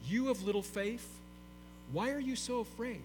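soft and unhurried, no scolding; gentle pastoral. Acted. Jesus answering the frightened disciples, soft and tender. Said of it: "how pastoral Jesus is".

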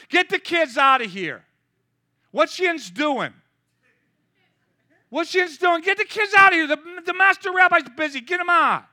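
put-on loud, high yelling to shoo the kids off; angry disciples. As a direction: bark at the parents to get out. Disciples shooing kids away.

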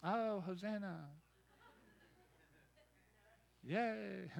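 soft, limp mock Hosanna; mumbled lazy cheer; half-hearted. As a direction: say it bored and unimpressed. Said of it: "They weren't just saying".

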